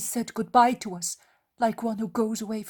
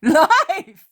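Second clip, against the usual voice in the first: loud, high cry of Life! falling firmly at the end; cry of faith; 8 semitones higher, 9 dB louder than usual. A narrator's reading. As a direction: proclaim Life! with certainty. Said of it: "Her voice is a cry of absolute faith".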